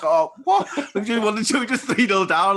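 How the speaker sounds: high, loud, laughing outrage at mum; mock indignant laughing cry; laughing disbelief. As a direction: squeal it out through laughter, can't believe she doesn't get it. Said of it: "I was like".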